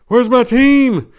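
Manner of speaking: slow, high, loud cry for the team; desperate cry. Acted. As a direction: demand to know where the team is. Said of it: "I asked".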